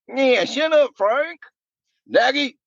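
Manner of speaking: high-pitched mock banter; squeaky teasing voice; goofy high voice. Second voice answering Frank.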